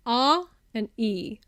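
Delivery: slow, separated R and E sounds; vowel broken down. Shown as a demonstration. Explaining the word I, its two sounds separated slowly. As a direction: pull R and E apart slowly. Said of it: "really quick succession of".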